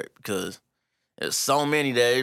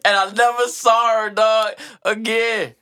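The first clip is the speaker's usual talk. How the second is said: mock lament; 8 semitones higher, 5 dB louder, slower than usual. Real, not acted.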